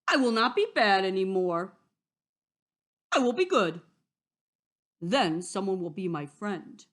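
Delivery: loud, resolute wolf voice; determined wolf. Acted. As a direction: declare it firmly as the wolf.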